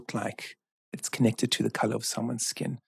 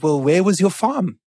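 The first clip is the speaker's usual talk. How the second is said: eager question; 7 semitones higher, 8 dB louder than usual. Retold or imitated. Mandela eagerly questioning the jailer.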